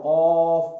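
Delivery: कॉफ loud and drawn out, closing on a bare f; loud, long कॉफ with no final vowel; vowelless ending. Shown as a demonstration. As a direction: stretch the vowel in कॉफ, then stop on f. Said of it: "काफ पढ़ते हैं".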